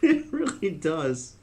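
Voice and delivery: high, slow words through laughter; drawn-out, laughed reply; laughing agreement. Real, not acted. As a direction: agree while still giggling. Laughing at the joke.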